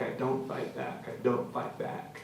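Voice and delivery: whispered surrender while being beaten. Acted. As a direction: let the words fade to a whisper. Repetition fading to a whisper.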